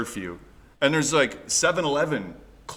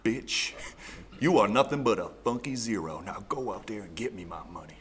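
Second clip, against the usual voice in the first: audiobook pimp; 4 dB quieter than usual. Retold or imitated. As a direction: imitate a pimp ordering someone around, softly. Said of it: "There's an audio book too".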